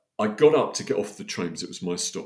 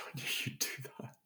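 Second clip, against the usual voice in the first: softer, breathy whispered promise; God's whisper; 3 semitones higher, 17 dB quieter than usual. Retold or imitated. Speaker reliving God whispering praise to him, whispered. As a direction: whisper it like a voice in the heart. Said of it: "He said".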